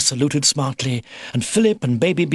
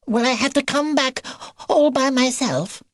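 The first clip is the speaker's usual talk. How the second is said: very high, squeaky worried question; frightened baby beaver; 14 semitones higher than usual. A narrator's reading. Baby beaver dreading the forest alone, squeaky and anxious. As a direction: pipe it up anxiously. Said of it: "shivering at the thought".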